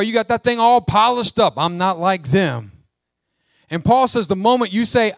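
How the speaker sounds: loud rebuke to the church; forceful preaching. Acted. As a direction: call them out from the pulpit.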